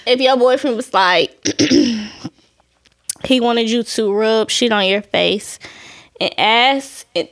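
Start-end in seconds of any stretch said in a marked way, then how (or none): none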